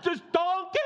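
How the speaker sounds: enraged cartoon yell at a donkey; Shrek. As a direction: bellow at the donkey like a cartoon ogre. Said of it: "I can hear Shrek's voice".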